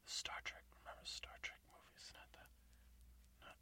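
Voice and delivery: very soft, unvoiced whisper; whispered aside. Real, not acted. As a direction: whisper it off-mic.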